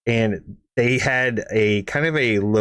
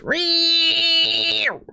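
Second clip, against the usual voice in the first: high drawn-out engine screech; high mechanical squeal; grinding screech. Retold or imitated. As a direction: imitate the broken transmission whining. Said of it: "all I hear is".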